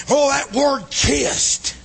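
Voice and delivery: ordinary delivery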